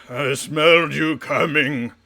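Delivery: booming troll call. Acted. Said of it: "the troll kind of calls out".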